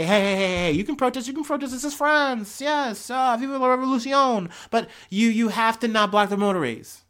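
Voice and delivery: hammed-up French accent, pitched high. French government warning protesting farmers, in a hammy French accent. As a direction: play a French official in a mock French accent. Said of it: "my French accent is terrible".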